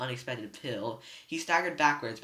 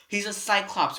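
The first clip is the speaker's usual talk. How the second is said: warning; 7 semitones higher, 7 dB louder than usual. A narrator's reading. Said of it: "Grover warned".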